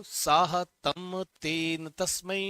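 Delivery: slow, deliberate chant of साहतम तेन तस्मै; slow recitation. Shown as a demonstration. Teacher prompting students to repeat, chanting slowly. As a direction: lead the class through it slowly. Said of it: "बोलो".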